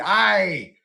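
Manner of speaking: a hammed-up Scottish "aye"; Scottish accent. Shown as a demonstration. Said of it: "you have to say "yeah" in a Scottish accent".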